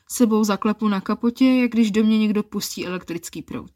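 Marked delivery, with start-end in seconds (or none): none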